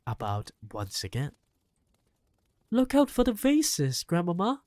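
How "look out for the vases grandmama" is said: put-on high, loud boyish warning call; alarmed warning. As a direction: warn her quickly about the vases. Boy warns grandmother waving stick.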